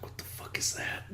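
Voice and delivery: hushed, unvoiced disbelief; whispered disgust. Chat voiced as disgusted characters, whispering.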